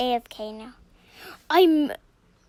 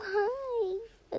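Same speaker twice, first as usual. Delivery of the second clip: ordinary talk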